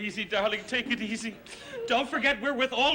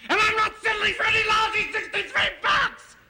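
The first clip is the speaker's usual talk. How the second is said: high, exploding yell; furious shout; 9 semitones higher, 4 dB louder than usual. Acted. Policyholder refusing a lousy settlement, furious shout. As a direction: burst out shouting, all control gone.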